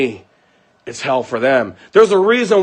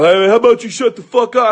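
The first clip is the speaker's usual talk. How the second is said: imitated mouthy heckler, pitched up; mock the boyfriend's higher-voiced taunt; mouthing off; 5 semitones higher than usual. Boyfriend yelling from the car, mouthing off. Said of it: "He starts running his mouth".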